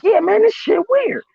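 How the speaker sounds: high, slow, baffled voice; slow, high bemused voice; mock Tampa friend. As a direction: play the puzzled friend. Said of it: "He was like".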